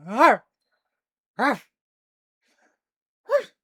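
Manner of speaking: high sharp barks; dog bark. Pretend. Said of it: "I'm trying so hard".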